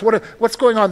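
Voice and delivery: ordinary talk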